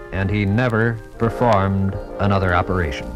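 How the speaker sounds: normal talking voice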